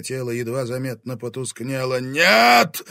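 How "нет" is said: high, loud howled cry. A narrator's reading. Fire dragon seeing its power fade, howl. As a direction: howl it out in agony. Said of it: "взвыл огненный дракон".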